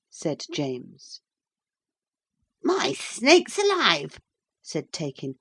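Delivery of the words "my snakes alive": high, slow comic exclamation; surprised magician. A narrator's reading. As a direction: exclaim like a startled old wizard.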